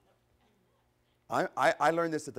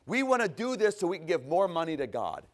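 mock piety; 5 semitones higher, 6 dB louder than usual. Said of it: "yeah, right".

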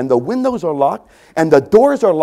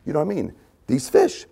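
ordinary delivery

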